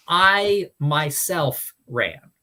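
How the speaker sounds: "I myself" stretched slowly and stressed; emphatic pronoun. Shown as a demonstration. Teacher showing Greek emphasis to listeners, stressed "I myself". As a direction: lean hard on "I myself", unhurried. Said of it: "adding emphasis".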